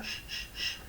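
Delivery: "sh, sh, sh" hissed softly with no voice; just air, repeat "sh" three times; unvoiced sh held as an example; voiceless sh. Shown as a demonstration. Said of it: "no suena acá".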